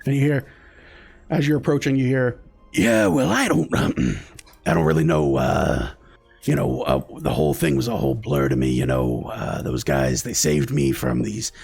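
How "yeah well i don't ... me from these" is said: low, rambling survivor voice, lost in the telling; a shaken man mumbling through his story; dazed rescued man. Acted. Dug-up survivor tells the guards, low and rambling.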